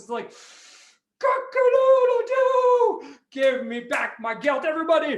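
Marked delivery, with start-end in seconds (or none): 1.2-5.2 crowing rooster. Acted. Rooster to King Antiochus demanding its gelt, crowing loudly.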